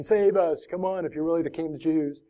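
high, fast taunt at Jesus; mocking thief. Thief on the cross taunting Jesus, high fast jeer. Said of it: "one mocking him".

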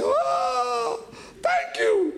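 high-pitched imitated sobbing; mock wailing. Grateful Vietnamese man weeping to soldier, high mock wail. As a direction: imitate a grown man bawling his thanks. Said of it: "él lloraba, él hacía".

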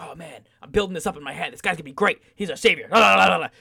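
hyped-up fan gushing, building into loud babble; mock rising hype that spills into nonsense la-las; overexcited fan. Sports podcaster mimicking fans overhyping a new rookie, gushing excitedly.

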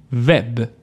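Web with a German-style W, a v sound; isolated "Web", v at the start; Swedish pronunciation model. Shown as a demonstration. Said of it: "zwei Wörter mit W nachsprechen".

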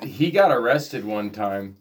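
ordinary talk

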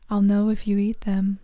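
ordinary delivery

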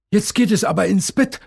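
put-on a cheerful dad sending his girl to bed, brisk; lightly voiced father, fast; Papa Wassermann. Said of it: "sagt Papa Wassermann".

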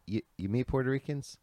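normal talking voice

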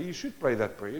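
ordinary talk, nothing marked

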